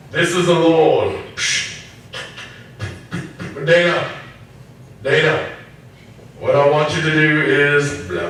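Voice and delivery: hammed-up divine announcement calling Dana; mock voice of God. Preacher mocks a booming God calling Dana, hammed-up. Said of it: "God doesn't usually talk like that".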